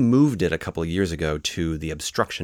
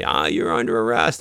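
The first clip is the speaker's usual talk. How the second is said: raised, louder mock yell; calling out; 6 semitones higher, 6 dB louder than usual. Officer repeating it to the distant person, mock yelling. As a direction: yell it as if across a field. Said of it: "you yell out and you say".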